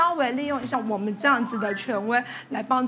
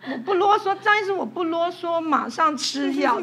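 put-on whiny child voice, higher pitched; frightened child promising; 4 semitones higher, 4 dB louder than usual. Child pleading with the doctor, whiny and higher pitched. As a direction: play the scared kid swearing he'll take his medicine. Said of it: "他都会说".

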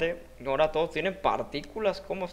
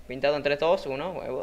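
ordinary talk, nothing marked

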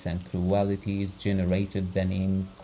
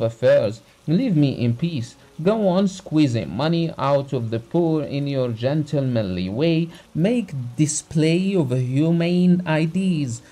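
raised, heated voice of an insulted doctor; the doctor's indignant outburst, loud and high; angry tirade; 5 semitones higher, 5 dB louder than usual. A narrator's reading. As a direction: scold him hotly, climbing higher. Doctor berates Abogin, beard quivering.

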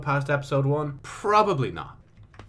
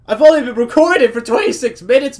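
high, loud, worked-up delivery; loud, excited high voice; amazed exclaiming; 10 semitones higher, 12 dB louder than usual. Real, not acted. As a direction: burst out in disbelief at the luck. Amazed at early find.

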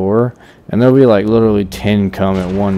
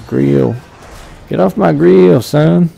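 mock tough guy; 9 semitones higher, 5 dB louder, slower than usual. Mocking partner's catchphrase.

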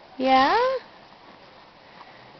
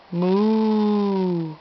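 playful cow moo; 6 semitones lower, 3 dB quieter than usual. Pretend. Parent asks what cow says.

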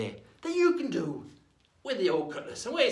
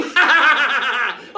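loud, high staccato guffaw; pirate laugh; 7 semitones higher, 16 dB louder than usual. Acted. Pirate laughs at his counting joke, loud guffaw. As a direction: roar with laughter at your own gag.

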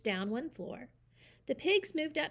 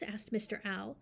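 ordinary delivery, nothing marked

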